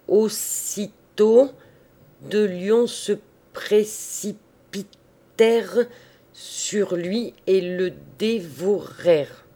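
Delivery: loud, slow, syllable-by-syllable reading; grave child reading. A narrator's reading. Little girl gravely reading about the lions, syllable by syllable. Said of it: "continuait sa lecture d'un air grave".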